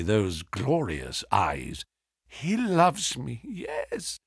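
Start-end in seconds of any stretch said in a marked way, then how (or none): 2.3-4.2 high, hushed woman's voice; lightly voiced wife, soft and wounded; grieving wife. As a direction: speak softly through her hurt.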